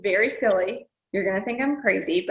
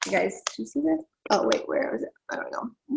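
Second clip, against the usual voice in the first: usual speaking voice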